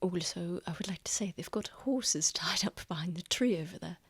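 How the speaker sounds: unvoiced whisper. Real, not acted. Game master telling players a secret, whispered. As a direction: hush it like a secret.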